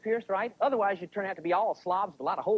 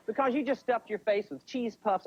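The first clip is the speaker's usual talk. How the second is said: ordinary delivery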